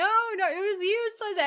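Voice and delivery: high, casual dismissive voice; mock Mike. Mike shrugs off losing the map, high and dismissive. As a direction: brush it off lightly. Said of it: "he's like".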